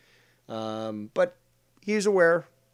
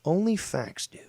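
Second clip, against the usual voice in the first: ordinary delivery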